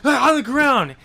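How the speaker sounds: loud barked order from a cop; cop shouting. Retold or imitated. Cop yells at the man walking out. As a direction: yell it like a cop pulling a gun. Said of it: "the cop's like".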